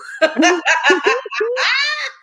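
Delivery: loud shrieking laugh; high shrieking cackle. Real, not acted. Laugh heard.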